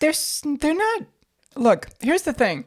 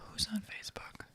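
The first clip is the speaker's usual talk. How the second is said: breathy whispered question; whispered reply matching her whisper; 21 dB quieter than usual. Acted. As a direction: whisper it back, conspiratorial.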